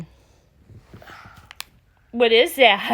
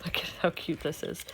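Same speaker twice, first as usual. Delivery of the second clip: unvoiced whisper, much softer; whispered aside; 6 semitones lower, 14 dB quieter than usual. Real, not acted. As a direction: whisper it to the camera. Aside while dressing child.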